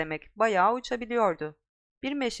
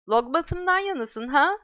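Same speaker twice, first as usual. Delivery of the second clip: taunt; 6 semitones higher, 5 dB louder than usual. Acted. Mean girl taunting.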